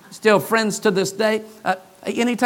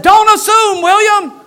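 loud, much higher scolding; angry rebuke; 14 semitones higher, 10 dB louder than usual. Retold or imitated. Pastor scolding a staff member who spoke for him, with an angry shout. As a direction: slam it down as a final rebuke.

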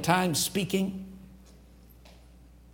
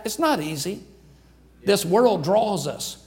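ordinary talk

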